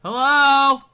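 loud, high drawn hail; calling out. Real, not acted. Gamer hails another player, calling out loudly. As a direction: call across to a stranger.

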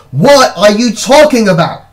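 loud, high exasperated yell; screamed demand. A narrator's reading. Brother on a chair explodes at sister, screaming. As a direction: yell it at the end of patience. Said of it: "climbed onto my chair and screamed".

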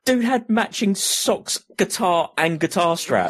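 high, slow delighted gush; excited. Real, not acted. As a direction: gush about how cute it was. Gushing over cute guitarist.

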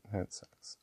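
soft whispered aside. Real, not acted. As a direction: drop to a whisper. Aside about other people's problems.

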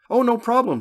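high, loud service singsong; lifted, sing-out customer-service reply; intoning agent. A narrator's reading. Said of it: "she intoned".

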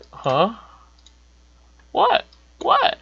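rising to a high, loud what what; shocked what. Retold or imitated. To the doctor, squeaking what in disbelief. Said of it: "I'm just just like".

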